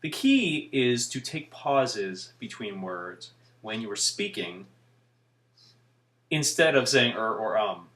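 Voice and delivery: "The key is to take pauses" slowed right down, spaced out; deliberate pauses. Shown as a demonstration. Vlogger showing how to avoid filler words, pausing deliberately before "instead". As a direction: leave a long silent gap after "when you are speaking". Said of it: "See what I did there?".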